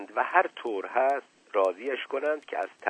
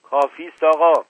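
loud high outburst; shouting; 6 semitones higher, 9 dB louder than usual. A narrator's reading. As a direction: cut them off with a shout. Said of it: "فریاد زد".

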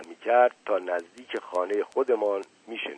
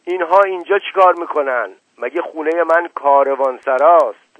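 louder, higher angry shout; 6 semitones higher, 8 dB louder than usual. A narrator's reading. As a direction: yell at the unwanted guests. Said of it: "فریاد زد". (فریاد خشمگین)

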